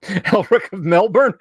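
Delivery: high-pitched, cracking up between phrases; laughing hard. Real, not acted. Streamer tickled by the Elric of Melbourne joke, laughing hard. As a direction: barely get it out through real laughter.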